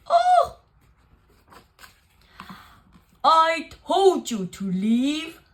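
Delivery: loud, slow exclamation; startled bear booming at the mouse; crying out. Acted. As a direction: bear cries out in alarm. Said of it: "cried Bear".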